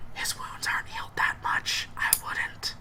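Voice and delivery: unvoiced whispered warning. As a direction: keep it secret from the patient. Guard answers in a whisper.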